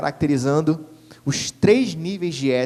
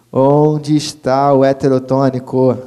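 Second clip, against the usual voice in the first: louder repeat; 2 semitones lower, 9 dB louder, slower than usual. Shown as a demonstration.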